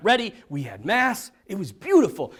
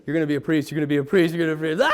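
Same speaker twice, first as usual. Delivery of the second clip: low, insistent looping thought; mounting mock panic on a low pitch; racing inner panic; 6 semitones lower, much faster than usual. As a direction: repeat it like an alarm building in your head. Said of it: "all that's going through my head".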